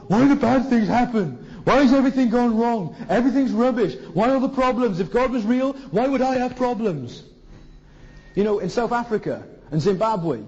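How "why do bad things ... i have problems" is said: higher, louder moaning voice; mock the self-pitying complainer; whiny complaining Westerner. Preacher mocking Western complaints about suffering, whining. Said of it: "In Britain and the West, it's all".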